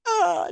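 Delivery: very high short shriek; mock shriek. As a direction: shriek like a startled possessed girl. Imitating girl's shriek.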